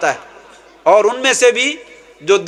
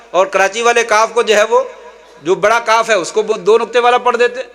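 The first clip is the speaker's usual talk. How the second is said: ordinary talk, nothing marked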